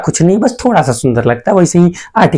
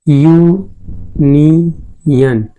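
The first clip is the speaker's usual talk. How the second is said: Union very slowly, as यू... नि... यन; syllable-by-syllable pronunciation; much slower than usual. Shown as a demonstration. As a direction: stretch each syllable of union apart. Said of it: "इसका pronunciation देखेंगे". (Union को अक्षर-अक्षर खींचकर धीरे बोलें)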